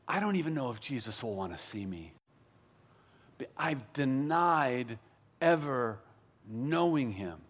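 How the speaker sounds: low and soft, with heavy pauses; ashamed, downcast thought. Acted. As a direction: confess it quietly to yourself. Imagines Peter's ashamed thoughts.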